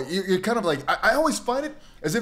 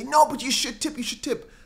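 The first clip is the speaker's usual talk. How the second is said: higher mock-pleading voice; imitated insistence; 8 semitones higher than usual. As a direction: press the point in a pitched-up voice. Imitating people insisting on tipping.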